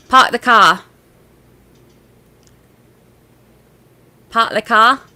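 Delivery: dropped R's, loud Boston-style; Boston accent. Shown as a demonstration. Streamer attempts Boston on a viewer's request, Boston accent. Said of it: "Brandy, can you say".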